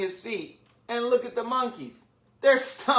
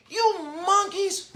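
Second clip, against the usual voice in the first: high, play-acted indignant call; quite angry peddler; 8 semitones higher than usual. A narrator's reading. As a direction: scold monkeys in a mild storybook temper. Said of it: "Now he felt quite angry".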